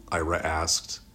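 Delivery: ordinary delivery, nothing marked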